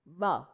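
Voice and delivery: व as English v, teeth on lip; labiodental v. Shown as a demonstration. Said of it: "upper teeth, lower lip".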